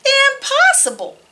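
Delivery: high, shocked exclamation; disbelieving farmer. Acted. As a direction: cry out in disbelief. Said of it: "Cows that type".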